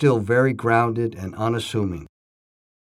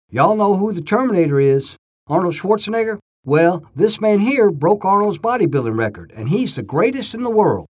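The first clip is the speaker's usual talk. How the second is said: put-on higher and a bit louder, hyping up a crowd of kids; enthusiastic introduction; 6 semitones higher, 3 dB louder than usual. Pastor introducing a champion to kids, lively and excited. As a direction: pump up the kids about their guest.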